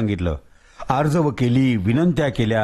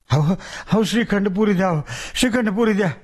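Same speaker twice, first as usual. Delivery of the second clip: higher, bargaining whine; wheedling ghost; 6 semitones higher than usual. Acted. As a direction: beg and bargain. Ghost begging.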